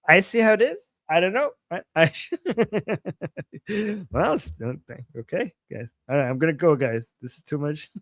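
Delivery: ordinary talk, nothing marked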